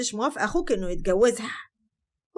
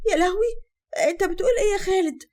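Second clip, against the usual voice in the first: horrified cry; 10 semitones higher, 6 dB louder than usual. Acted. Wife horrified at husband's suspicion.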